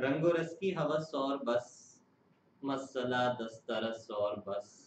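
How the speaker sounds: slow measured ghazal recitation; poem recitation. Acted. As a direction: recite the couplet slowly. Repeats couplet.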